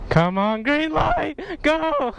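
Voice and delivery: high excited yell. Real, not acted. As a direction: burst out high at the light. Light turns, yelling.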